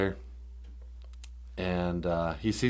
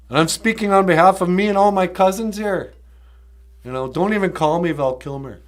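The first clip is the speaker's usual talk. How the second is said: loud, higher, mock-angry declaration; hammed-up outrage, voice raised in defiance; mad Val Kilmer; 7 semitones higher, 8 dB louder than usual. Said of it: "Val Kilmer's just mad. He's shaking".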